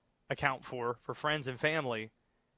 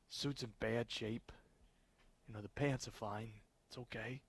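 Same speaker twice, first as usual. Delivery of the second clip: put-on toned-down Jersey accent, softer; overdone regional accent; 10 dB quieter than usual. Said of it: "That's better".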